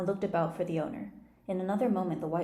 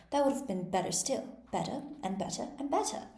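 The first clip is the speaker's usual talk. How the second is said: rising to a squeak; 5 semitones higher than usual. A narrator's reading. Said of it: "Her voice went higher with each better".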